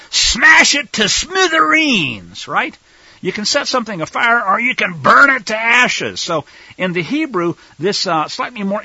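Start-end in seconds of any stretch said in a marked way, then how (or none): 0.1-2.5 high, loud, slow bellow of smash it to smithereens; emphatic shout. Shown as a demonstration. Preacher showing the emphatic Hebrew verb, shouting. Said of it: "And it basically is real emphatic". 3.2-6.2 loud, raised voice building to burn it to ashes; emphatic. Shown as a demonstration. Preacher contrasting weak and strong verbs, louder. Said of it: "And it basically is real emphatic".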